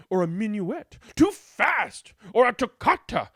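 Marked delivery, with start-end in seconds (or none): 1.1-2.1 scolding mom. Acted. Mom rejects minuet.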